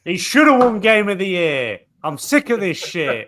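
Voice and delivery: loud, hammed-up angry rant; playful furious tirade; mock outrage. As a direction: rage like a sore-loser gamer. Acting out the screaming gamer rant.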